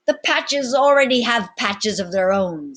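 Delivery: loud, high swaggering captain; commanding captain. Acted. Captain addressing his pirates, loud and bold. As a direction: address the crew like an old sea dog.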